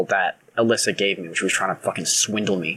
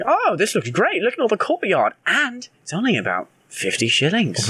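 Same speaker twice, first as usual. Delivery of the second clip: high, dazzled house-hunter; gushing buyer; 9 semitones higher than usual. Retold or imitated. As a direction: gush about the house, pitched up. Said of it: "And I was like".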